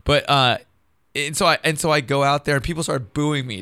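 normal talking voice

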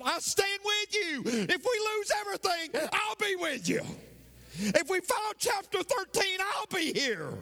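high, sustained preacher's cry; retold vow preached at full pitch; impassioned pledge. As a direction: cry out the promise at the top of your range. Preacher voices a rousing pledge of loyalty.

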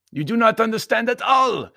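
very high, loud insistence; emphatic Baron. A narrator's reading. Baron objecting to Anthony, high and emphatic.

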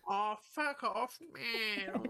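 hammed-up drugged-out Aussie; bogan drawl. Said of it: "mock bogan".

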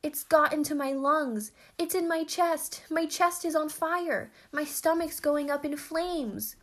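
high, loud wail of alarm; spluttered agony. A narrator's reading. Manly lover with his chest on fire, panicked wailing. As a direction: cry out as if burning up inside. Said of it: "spluttered the manly lover".

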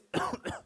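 short mimicked cough; imitated cough. Man in the store coughs, imitated cough. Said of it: "y nomás dice".

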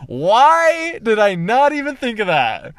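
loud, high, drawn-out exasperation; exasperated self-reproach. Retold or imitated. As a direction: stretch it out, kicking yourself. Berates herself for standing up.

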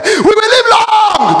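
slow, high, full-voiced declaration; a long high preaching shout. Real, not acted. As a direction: lift the room with it. Crowd declaration from the pulpit.

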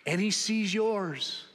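slow, deliberate, weight on "sees"; slow emphasis. Real, not acted. Preacher telling the congregation God sees them, slow and deliberate. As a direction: land "sees" slowly and firmly.

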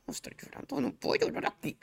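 high, soft whisper to herself; whispered self-talk. Retold or imitated. Woman recalling telling herself not to cry, whispered. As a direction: whisper it as if forbidding yourself to cry. Retold inner voice, holding back tears.

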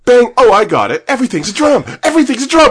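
loud, high, ecstatic chant; triumphant shout. Retold or imitated. Sketch guy finally getting the drum, loud high triumphant chant. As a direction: shout it like a breakthrough.

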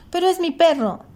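high-pitched, insistent protest. A narrator's reading. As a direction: protest with voice rising well up. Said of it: "levantando la voz".

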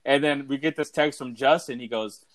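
ordinary talk, nothing marked

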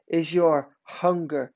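ordinary talk, nothing marked

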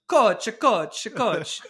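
high, loud exchange-student voice calling out; mock Italian student asking the coach; imitating Marco's accent. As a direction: call to coach in a goofy Italian lilt. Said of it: "the "what is basically" voice".

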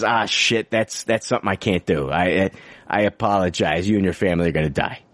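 low-pitched, deadpan comic apology; resigned superhero. Acted. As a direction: deliver the hopeless news low and matter-of-fact. Voices useless superhero giving up.

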